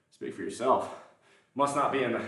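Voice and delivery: ordinary talk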